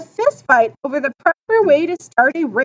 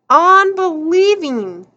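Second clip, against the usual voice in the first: usual speaking voice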